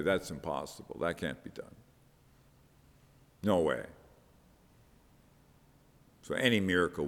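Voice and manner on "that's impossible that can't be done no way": low, soft, offhand dismissal; dismissive. Acted. Moderns hearing of a miracle, quietly dismissive. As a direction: wave it away quietly, in a lowered voice. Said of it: "you know, just dismiss it".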